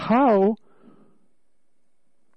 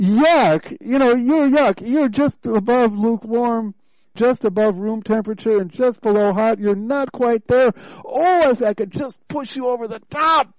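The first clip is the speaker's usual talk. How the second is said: God voiced higher and louder, disgusted at yuck; a raised, pleading divine voice, louder and higher; God's voice; 6 semitones higher, 3 dB louder than usual. Preacher voicing God to the congregation, disgusted and pleading. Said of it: "And so God is saying".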